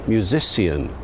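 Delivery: musician said as myoo-ZISS-ee-an, four syllables; musician with an s sound and -see-an ending; early Shakespearean pronunciation. Shown as a demonstration. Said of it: "pronouncing the word musician as".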